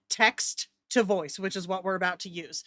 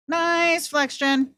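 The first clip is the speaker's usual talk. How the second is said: Nice flex, Jen called out higher and louder; a higher, louder impressed shout-out; excited exclaim; 7 semitones higher, 4 dB louder, much slower than usual. Real, not acted. Repeats it, now exclaimed.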